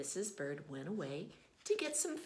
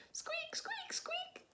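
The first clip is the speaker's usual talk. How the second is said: very high, whispered little squeaks; tiny squeaks from inside the egg; faint chick squeaking from inside the shell; 4 dB quieter, slower than usual. Acted.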